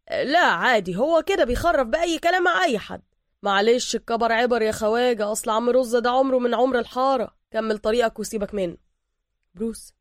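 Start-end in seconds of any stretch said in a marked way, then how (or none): 0.1-8.8 louder, lively neighborhood barber voice; easygoing barber. A narrator's reading. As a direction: reassure a stranger in a loud, casual voice.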